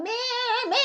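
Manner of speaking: imitated sheep's bleat; livestock bleating. Livestock all over the temple, imitated bleating. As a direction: bleat like penned animals. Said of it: "이런 가축들이 여기저기서 막".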